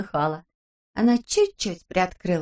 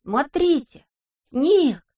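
higher, louder thrilled cry; excited exclamation; 7 semitones higher, 4 dB louder, much slower than usual. Acted. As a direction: call out in delight at snow. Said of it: "Бося воскликнул". (радостный возглас)

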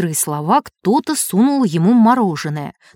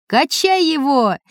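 crowd cheer; 5 semitones higher, slower than usual. A narrator's reading. Crowd lifting the boy.

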